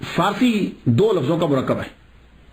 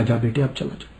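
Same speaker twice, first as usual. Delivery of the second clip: mother sending him off; 6 semitones lower, 4 dB quieter than usual. Retold or imitated. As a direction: lower and softer, a hint of the mother. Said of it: "پہنانے کے بعد کہا". (ماں کی دھیمی، نیچی آواز میں)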